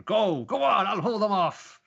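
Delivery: high, loud acted shout; urgent urging. Father urging his son out of the pit, urgent shout.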